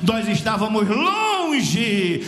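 loud, drawn-out revival cadence; impassioned preaching. Real, not acted. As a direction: proclaim it at full force, stretching key words. Preaching at full force.

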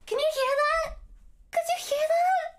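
excited call into the open air. Real, not acted. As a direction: call out to test the echo. Calling out to test the echo.

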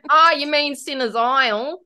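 bogan Australian accent. Shown as a demonstration. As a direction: lay on a thick outback Australian twang. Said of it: "how would you say, like, Sinners Isle in the most, like, bogan Australian accent".

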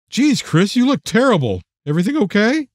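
lightly voiced woman, pitch lifted in concern; concerned friend. A narrator's reading. Friend at the door checking on Chris, concerned.

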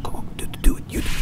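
urgent hushed whisper. Real, not acted. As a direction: whisper fast so nothing hears you. Sneaking past a monster.

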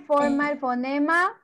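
ordinary talk

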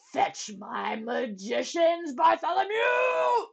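slow royal shout, rising high and loud; commanding king. A narrator's reading. Stuck king summoning his magicians, loud command. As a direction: bellow the order, stretching the name. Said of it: "he commanded".

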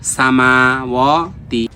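সামাওয়াতি with মা and ওয়া drawn out, তি short; elongated recitation. Shown as a demonstration. Teacher modelling madd for students, slow drawn-out সামাওয়াতি. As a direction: stretch both long vowels in সামাওয়াতি slowly. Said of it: "মাদের হরকত এক আলিফ".

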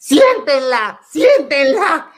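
high, loud frantic plea, slowing; desperate call to sit her down; mock panicked cry; panicked mother. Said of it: "se entra en desesperación".

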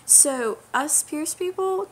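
ordinary delivery

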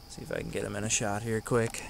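usual speaking voice

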